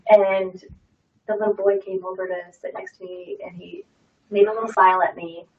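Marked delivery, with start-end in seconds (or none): none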